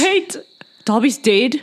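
high, sudden alarmed question; shocked. Acted. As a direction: gasp it out in shock. Drunk elf shocked.

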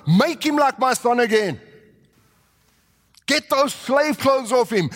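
excited father's call; urgent order. Retold or imitated. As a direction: command it with eager joy.

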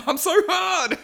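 high, drawn-out joking outburst; mock arousal. Podcaster joking about loving the scene, hammed-up excitement. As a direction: blurt it slowly in a silly high voice. Joke outburst, then "I'm kidding.

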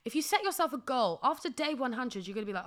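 usual speaking voice

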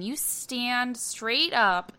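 slowed-down quote, drawn out. Retold or imitated. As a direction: stretch every word slowly. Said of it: "Lori Petty saying".

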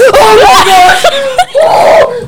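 high shrieking laugh-shout of triumph; laughing excited shout. Real, not acted. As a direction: scream it out laughing, overjoyed. Triumph after winning spelling.

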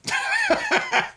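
a sudden burst of high-pitched laughter; a real high laugh at a friend's bar plan. Real, not acted. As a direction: cackle up high at the joke. Laughs at pandemic joke.

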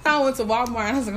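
ordinary talk

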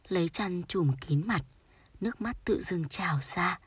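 usual speaking voice

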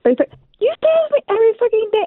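high fast complaint; rapid high exasperation; exasperated teasing. Real, not acted. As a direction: tease him about his habit. Wife mocks his catchphrase, heated.

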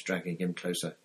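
ordinary delivery, nothing marked

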